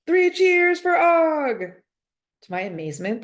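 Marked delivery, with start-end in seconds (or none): 0.1-1.6 high, loud, slow shouted cheer; rally the class with a big shout. A narrator's reading. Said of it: "A J loudly shouts".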